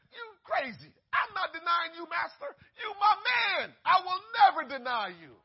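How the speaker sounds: loud, high, brash protest; boastful Peter. Acted. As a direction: insist loudly, full of bravado. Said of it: "Peter had told God".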